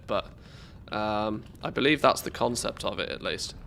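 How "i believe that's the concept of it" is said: ordinary delivery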